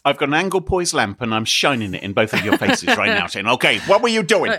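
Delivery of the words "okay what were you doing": interrogating. Acted. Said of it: "This is your bad cop voice".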